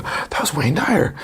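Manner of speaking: hushed, slow, awestruck aside; awed whisper. Retold or imitated. Young runner stunned to spot his hero on the beach, hushed awe. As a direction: breathe it out in disbelief.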